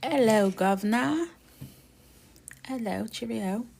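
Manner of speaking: high, playful British greeting; hammed-up British "guv'nor" hello; British accent. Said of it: "I'll break into a British accent".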